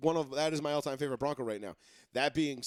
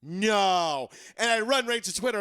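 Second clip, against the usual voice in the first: louder and higher, rushing to the news; the story hitting its climax, louder; building excitement; 5 semitones higher, 6 dB louder, slower than usual. Real, not acted. Fan retelling the trade news, louder and excited.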